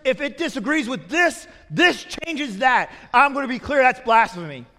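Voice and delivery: loud, high, emphatic pulpit denunciation; preaching at full force. Acted. Preacher denouncing a pastor's claim to the congregation, full-force preaching.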